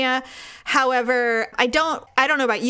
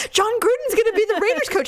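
mock excited news; 10 semitones higher, faster than usual. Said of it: "I'm all".

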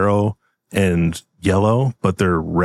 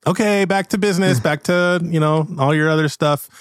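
bright raised call; high host voice; 8 semitones higher than usual. Acted. Owner calls customers back to business, high. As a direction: wave everyone back to work.

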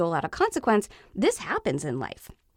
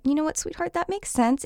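comforting parent voice; 9 semitones higher than usual. Shown as a demonstration. Mother normalizing her child's fear after a fall, soothing. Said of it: "then you could normalize it".

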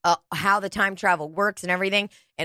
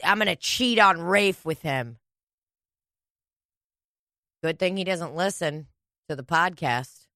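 ordinary talk

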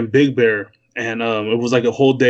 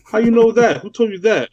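higher, mock suspicious; pitched-up retold double question, half suspicious; surprised questions; 8 semitones higher than usual. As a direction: imitate a guy caught off guard about his hometown. Retelling a suspicious reaction.

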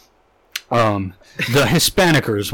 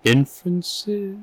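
incredulous echo; 4 semitones higher, 4 dB quieter, much slower than usual. Real, not acted. Mocking the fancy word.

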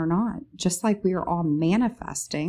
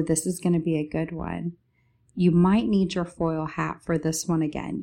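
ordinary talk, nothing marked

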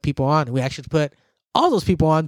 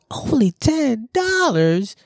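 surprised mock voice; 7 semitones higher, much slower than usual. Miggy quoted reacting to price.

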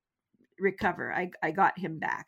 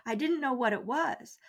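ordinary delivery

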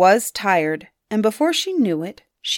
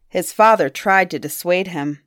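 ordinary delivery

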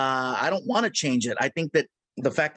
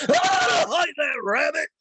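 high hollering Yosemite Sam; 10 semitones higher, slower than usual. Retold or imitated. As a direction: bellow it like a hot-headed cowboy. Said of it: "You want Yosemite Sam?".

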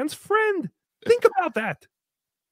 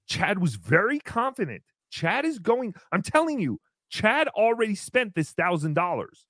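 ordinary delivery

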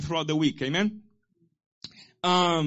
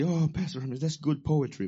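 dismissive listener; 4 semitones lower, 4 dB quieter than usual. Retold or imitated.

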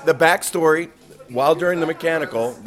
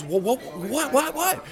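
rising higher and higher, flustered; flustered questioning; 11 semitones higher, 3 dB quieter than usual. Retold or imitated. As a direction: stammer out the questions in alarm. Purser asks anxiously what happened.

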